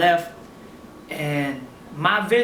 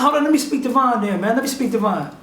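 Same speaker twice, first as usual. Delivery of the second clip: higher, mildly agitated retold demand; 5 semitones higher than usual. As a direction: insist on getting someone else on the line. Acted demand to speak to Von.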